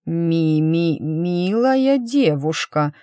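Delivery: slow, stammering "Ми-ми-"; nervous stutter. Acted. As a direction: stumble over the words from nerves. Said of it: "от волнения Свейн начал заикаться". (заикаясь от волнения)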